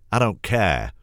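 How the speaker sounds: loud, high sulky shout; shouting boy. A narrator's reading. Little brother shouting at grandma before stomping off. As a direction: throw it back like a stroppy little boy. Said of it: "Davy shouts".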